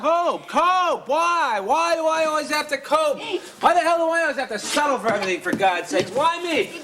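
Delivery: high, exasperated wail of protest; anguished outburst. Acted. Tom railing at Mom's advice to cope, anguished outburst. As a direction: throw the word back, voice leaping up.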